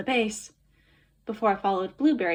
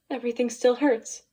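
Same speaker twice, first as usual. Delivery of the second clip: usual speaking voice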